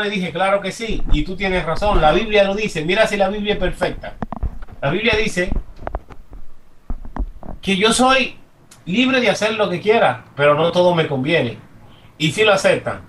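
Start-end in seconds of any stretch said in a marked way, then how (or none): none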